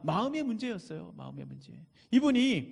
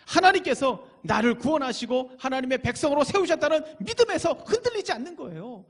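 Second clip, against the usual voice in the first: ordinary delivery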